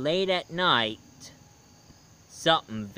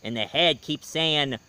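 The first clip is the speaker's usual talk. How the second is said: ordinary delivery, nothing marked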